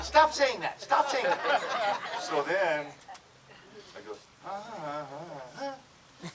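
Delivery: normal talking voice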